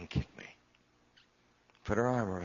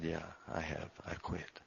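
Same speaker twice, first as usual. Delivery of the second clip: defeated; 2 semitones lower, 7 dB quieter than usual. Retold or imitated. Defeated reply to wife.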